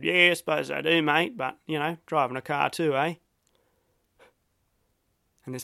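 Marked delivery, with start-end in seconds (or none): 0.0-3.1 put-on broadest Aussie accent. Brushing off the Breton hiker in a broad Australian accent. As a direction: lay the Aussie accent on thick, carefree. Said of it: "I put on my broadest accent".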